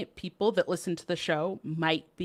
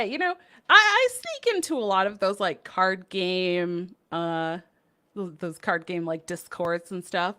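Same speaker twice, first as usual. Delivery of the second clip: ordinary talk, nothing marked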